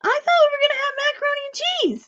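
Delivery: imitating the boy protesting about his macaroni; indignant little kid. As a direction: whine it like a six-year-old who's been cheated. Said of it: "He goes".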